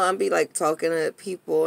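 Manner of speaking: normal talking voice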